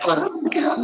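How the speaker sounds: high panicky squeak; mock panic. Swan panicking under the surface.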